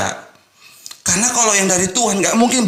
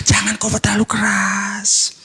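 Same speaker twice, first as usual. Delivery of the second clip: slow, drawn-out mock advice, pitched higher; mock cautioning; 5 semitones higher than usual. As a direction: imitate someone gently warning a preacher, dragging the words. Mimics advice given to preachers.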